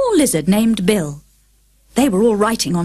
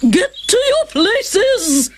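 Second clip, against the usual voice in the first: high, slow, imperious shout; the Queen roaring at the croquet players; Queen's thunderous order; 12 semitones higher, much slower than usual. A narrator's reading. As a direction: command it like thunder from the throne. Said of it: "in a voice like thunder".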